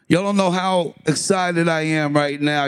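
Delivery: normal talking voice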